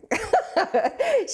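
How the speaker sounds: bright burst of laughter; a quick amused laugh; short laugh. Real, not acted. Laughing about her mom's coffee run.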